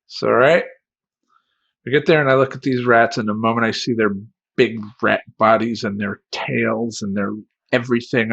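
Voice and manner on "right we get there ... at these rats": ordinary talk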